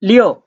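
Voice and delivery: Liu pitched high, then dropping sharply; short falling Mandarin tone on Liu; fourth tone. Shown as a demonstration. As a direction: drop Liu steeply from a high peak. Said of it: "the fourth tone".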